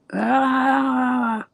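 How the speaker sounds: very high, loud mock scream; imitated scream. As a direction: let out a long piercing imitation wail. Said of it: "So he stopped screaming".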